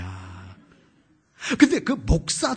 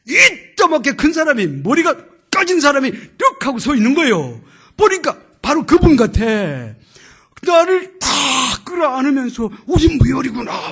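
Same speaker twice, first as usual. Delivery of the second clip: excited telling; 9 semitones higher, 13 dB louder than usual. Retold or imitated. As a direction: build to the reunion. Climactic reunion retold. (높고 크게 감격해서)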